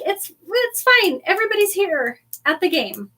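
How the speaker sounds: high-pitched, breezy self-comfort; mock reassurance. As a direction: insist lightly in a high pretend voice. [name] wrongly reassuring himself.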